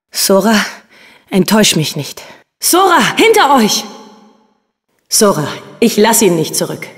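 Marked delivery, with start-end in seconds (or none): 2.6-3.8 high sudden warning call; urgent warning. Acted. Meg warns Sora of an attack from behind. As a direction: warn them sharply and fast.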